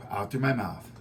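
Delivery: a long, soft, sighing breath out through the mouth. Shown as a demonstration. Teacher demonstrates relaxed breathing for students. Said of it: "Taking deep breaths in".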